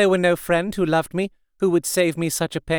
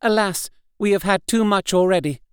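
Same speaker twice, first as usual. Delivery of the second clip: a touch of sorrowful resolve, higher; lightly voiced brave despair; 6 semitones higher than usual. A narrator's reading. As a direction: Mina telling the men to hide nothing. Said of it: "so brave, and yet so sorrowful".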